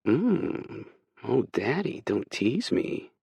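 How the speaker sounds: high, slow, coy purr; sultry tease. Acted. Hurricane flirts back at Kay, coy and sultry. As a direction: play the seductress, drawn out. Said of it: "She flashes me a sultry smile".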